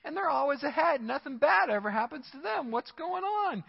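indignant psalmist asking how the wicked get ahead; exasperated psalmist. Retold or imitated. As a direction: voice climbing in disbelief. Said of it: "This guy's like".

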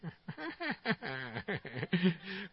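babbled gibberish breaking into laughter; mock chatter. Date filling quiet time in the car, mock babbling. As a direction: imitate nonstop jabbering, then laugh.

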